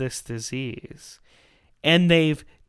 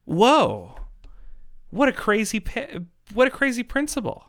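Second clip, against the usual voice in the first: mock astonishment; 6 semitones higher than usual. Mock shock at opponents' logic.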